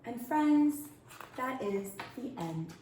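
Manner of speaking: ordinary delivery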